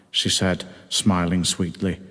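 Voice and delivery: ordinary delivery, nothing marked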